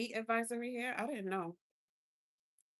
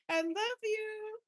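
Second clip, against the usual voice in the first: gushing; 13 semitones higher, 3 dB louder, much slower than usual. Real, not acted. Gushing thanks for cookies.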